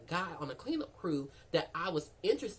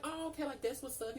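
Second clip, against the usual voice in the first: lightly acted, higher-pitched okay; retold casual reply; 9 semitones higher, 4 dB quieter than usual. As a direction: voice his easygoing reply up high. Retold texting guy's casual reply.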